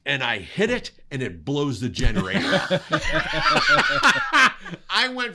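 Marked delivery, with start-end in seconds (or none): 2.5-4.8 big high laugh; loud real laugh that falls away; real burst of laughter. Real, not acted. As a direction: break up laughing at the memory. Generator blows, teller cracks up.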